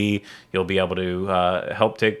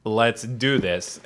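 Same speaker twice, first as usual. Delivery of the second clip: drawn-out announcer; 2 semitones higher, much slower than usual. Pretend. Mock grand show opener.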